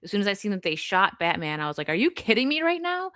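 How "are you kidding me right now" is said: mock outrage. Retelling outrage at Batman's death.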